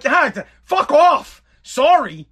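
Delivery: high, exasperated retold outburst; mad. Son rejecting mom's apology, mad. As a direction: send them away in acted anger. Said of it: "Oh, I was so mad".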